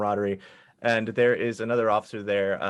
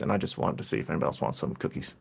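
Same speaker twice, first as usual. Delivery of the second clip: meek halfling mumble; 8 semitones lower, 6 dB quieter, much faster than usual. Acted. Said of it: "And he goes".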